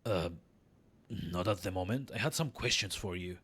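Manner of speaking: low, soft hero voice; reserved player character. Acted. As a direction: answer the midwife quietly and low.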